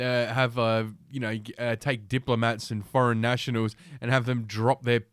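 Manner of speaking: usual speaking voice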